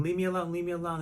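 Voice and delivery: mild imitation of a panicked scream; retold scream. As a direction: act out screaming to be left alone, held back. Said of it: "I'm screaming".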